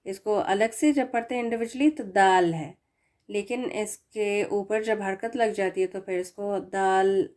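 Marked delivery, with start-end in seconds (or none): none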